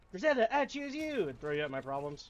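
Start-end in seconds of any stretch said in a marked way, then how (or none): 0.1-1.3 high, loud trainer battle cry; Pokemon trainer call. Retold or imitated. Player imagining throwing Griselda like a Pokemon, shouting. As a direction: call it out like tossing a Pokeball.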